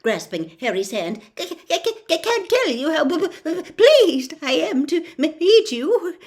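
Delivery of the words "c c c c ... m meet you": higher and louder, stuttering with a shaky laugh; a jittery teacher tripping on every word; nervous stammer. Acted. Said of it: "stammered Professor Quirrell".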